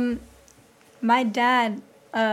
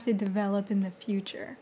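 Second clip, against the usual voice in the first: whispering; 4 semitones lower, 7 dB quieter than usual. Real, not acted. Trailing whispered musing.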